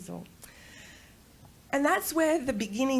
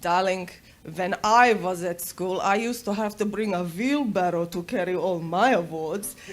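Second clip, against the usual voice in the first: put-on loud, lower Serbian-accented father; thick Serbian accent; 3 semitones lower, 5 dB louder than usual. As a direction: imitate a dad with a heavy Serbian accent. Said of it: "in his thick accent ... beautiful Serbian accent".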